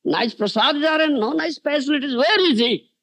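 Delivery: high, angry ranting demand. Retold or imitated. Angry Nityananda demands where Shivananda and his arrangements are, angrily. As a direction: rant indignantly, pitch climbing as you go. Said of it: "Nityananda Prabhu got angry".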